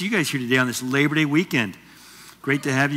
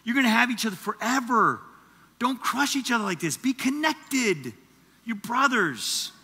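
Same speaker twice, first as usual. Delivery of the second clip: pleading dad; 5 semitones higher, 4 dB quieter than usual. Retold or imitated. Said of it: "he'd pull us together and be like".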